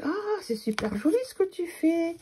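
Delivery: high, bright praise for the mirror; delighted compliment. Retold or imitated. Friends at the fair praising her work, high and delighted. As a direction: gush like an admiring friend. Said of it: "tout le monde a dit".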